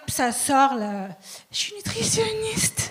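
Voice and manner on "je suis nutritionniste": high, squeezed, drawn-out voice; acting out talking without breathing; held breath, cheeks sucked in. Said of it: "J'arrête de respirer. Je suctionne mes joues".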